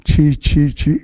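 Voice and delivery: low, scornful chee chee; a low, quiet tsk of contempt; disgusted tut. Real, not acted. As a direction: spit out the disgust under the breath. Disgust at opponent's remark.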